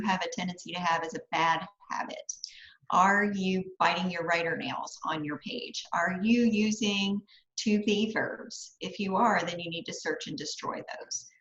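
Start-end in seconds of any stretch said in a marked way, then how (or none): none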